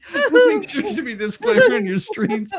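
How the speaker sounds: high, laughing words. Real, not acted. Laughing while joking to fellow streamers. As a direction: speak through a laugh.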